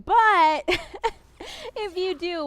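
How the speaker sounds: laughing through the words; high, loud laughing aside. Real, not acted. Joking about gambling addiction.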